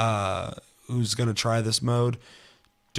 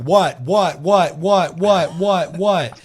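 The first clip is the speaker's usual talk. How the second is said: high, loud repeated what; loud mock-bewildered what; mock confused yelling; 7 semitones higher, 9 dB louder, much slower than usual. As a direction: bark out a dazed what over and over. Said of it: "I'm like".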